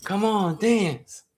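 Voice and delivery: high mock chant to dance; mock urging. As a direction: imitate the girls nagging him onto the floor. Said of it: "They're like".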